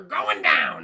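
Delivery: loud, drawn-out hype shout; playful battle cry. Pretend. Streamer hyping up the posse, loud playful battle cry. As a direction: holler it like a rallying taunt.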